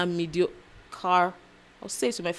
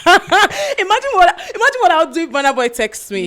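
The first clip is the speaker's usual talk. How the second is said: excited; 10 semitones higher, 7 dB louder than usual. Real, not acted. Excited fantasy about [name] texting.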